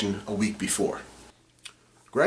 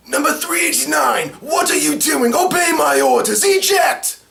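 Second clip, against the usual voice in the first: much higher, loud shouted villain orders; Specto villain voice; 8 dB louder than usual. Acted. The voice actor showing the villain Specto, shouting orders. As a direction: bark commands as the main bad guy. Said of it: "Specto sounds something like this".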